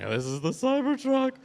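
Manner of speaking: high, hushed, jaw-dropped gasp of wonder; stunned onlooker seeing the truck for the first time; mock awestruck. Awed crowd reaction to Cybertruck reveal.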